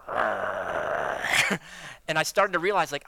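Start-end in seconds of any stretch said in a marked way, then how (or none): 0.1-1.6 low, irritated growl; low annoyed growl at a petty complaint; mock growl. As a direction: growl under your breath in annoyance. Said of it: "I just went like".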